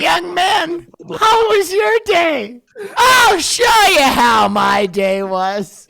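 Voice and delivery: high, loud furious yelling; angry shout. Pretend. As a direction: scream it like a furious parent. Acting out intrusive thought, shouting.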